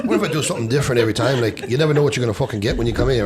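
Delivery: put-on Irish accent, brisk. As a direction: play it as a cheeky Irishman. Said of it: "come in with a different accent".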